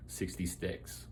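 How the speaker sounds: breathy whispered aside. Pretend. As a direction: drop to a whisper for the number.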